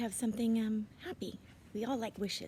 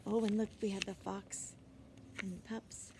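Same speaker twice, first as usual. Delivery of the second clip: ordinary delivery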